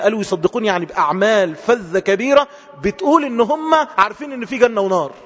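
normal talking voice

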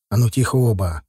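ordinary delivery, nothing marked

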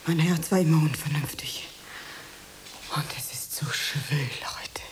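low, faint, breathless whisper; swooning whisper. Acted. Fainting woman whispering to her landlady, swooning whisper.

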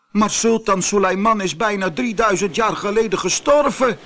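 high, urgent protest; crying out. Acted. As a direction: call out the objection to the genie. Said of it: "riep Abdullah". (dringend uitroepen)

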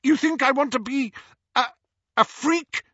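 high, loud sneering voice; scornful outburst. Acted. Petunia scorns her sister's magic school, high and loud. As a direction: spit out the contempt.

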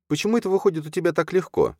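envious question in a high, quick voice; high and fast, a touch envious. A narrator's reading. Чарна to Юрген, wanting what comes easily to him, envious. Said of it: "спросила завистью".

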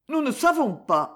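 lightly voiced Perrine, troubled. (jeune épouse inquiète, voix légèrement aiguë)

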